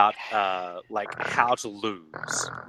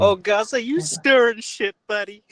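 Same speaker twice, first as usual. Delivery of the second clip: much higher, louder playful needling; teasing; 12 semitones higher, 5 dB louder than usual. Real, not acted. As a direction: poke fun at him. Teasing a fellow member.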